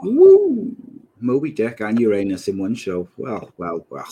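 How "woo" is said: high loud whoop; excited whoop. Real, not acted. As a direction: cheer like a crowd. Joins the Moby Dick joke.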